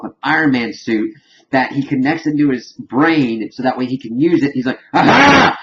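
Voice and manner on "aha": high, loud triumphant shout; triumphant aha. Retold or imitated. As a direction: crow in triumph like a mad genius. Triumphant retold exclamation.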